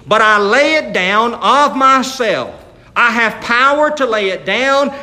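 slow, loud preaching cadence; scripture declamation. Real, not acted. Preacher quotes Jesus from John, slow and forceful. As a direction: proclaim the verse with weight on each phrase.

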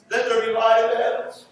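normal talking voice

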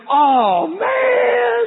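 put-on loud, high, drawn-out kid's groan; disappointed kid. Son groaning when dad explains, loud high wail. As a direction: wail it like a let-down boy.

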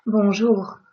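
Bonjour ending in a light uvular R; soft French R. Shown as a demonstration. Teacher modeling the correct bonjour. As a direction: let the R of Bonjour barely brush the throat. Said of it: "I'm not saying bonjour".

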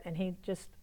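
ordinary talk, nothing marked

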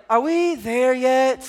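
put-on long drawn-out kid whine; whiny impatient child. Dad imitating restless daughter on a road trip, drawn-out whine. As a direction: mimic a restless kid, words stretched slowly. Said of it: "Charlie's like".

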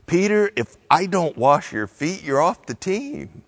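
stern. Retold or imitated. Jesus warning Peter he's off the team, mildly stern. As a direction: lay down an ultimatum, lightly acted. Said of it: "Jesus' words seem unusually stern".

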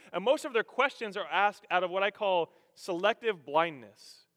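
ordinary talk

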